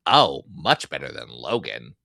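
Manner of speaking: put-on a botched Ryan Reynolds that drifted into some other accent; odd Deadpool accent. Deadpool answering Conan in a low, strange put-on accent. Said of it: "I don't know why I gave him that accent".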